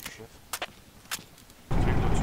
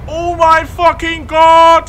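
slow, high, loud shriek; panicked scream; 14 dB louder, much slower than usual. Real, not acted. Panics as the missile comes.

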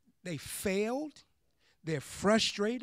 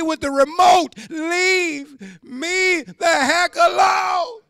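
higher, louder, slow dragged-out wail; wailing leave me alone; 7 semitones higher, 9 dB louder, slower than usual. Retold or imitated. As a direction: howl it like a fed-up man who wants no more. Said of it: "when they tired".

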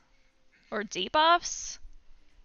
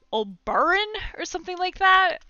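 exaggerated Southern accent, a bit higher; 8 semitones higher, 4 dB louder than usual. Gamer naming the boss in a Southern accent. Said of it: "I say it in a Southern accent".

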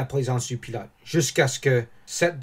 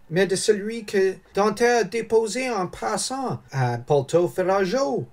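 high-pitched character voice of a schemer; insinuating schemer; 8 semitones higher than usual. Acted. (voix haut perchée d'intrigant)

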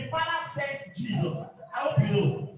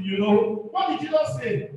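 ordinary talk

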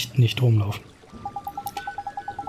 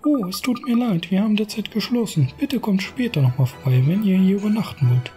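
high innkeeper voice; 7 semitones higher than usual. Acted. Reads innkeeper's lines in voice.

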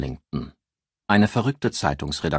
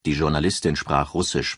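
plain reading voice

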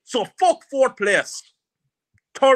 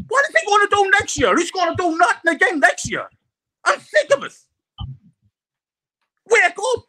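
raised, heated rant; fuming outburst; 7 semitones higher than usual. Real, not acted.

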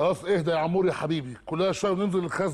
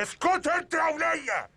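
snapping order; 8 semitones higher, slower than usual. Acted. Robber snaps at old woman.

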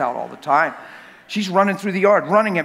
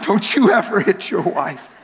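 laughing; 2 semitones higher, slower than usual. Real, not acted.

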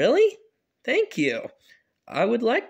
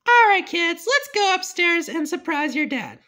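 put-on mom calling kids; 9 semitones higher than usual.

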